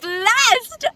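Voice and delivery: joyful exclaim of relief; overjoyed. Real, not acted. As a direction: shout out the good news. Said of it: "I'm so blessed".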